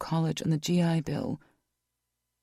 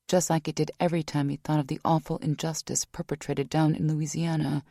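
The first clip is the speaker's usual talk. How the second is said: usual speaking voice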